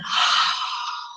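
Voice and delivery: long, voiceless whoosh of breath; audible exhalation. Shown as a demonstration. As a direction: sigh all the air out for the class. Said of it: "Audible exhalation".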